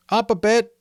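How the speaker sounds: high, loud small request; young boy's voice. A narrator's reading. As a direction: call out politely from inside the shelter. Simon voiced from shelter.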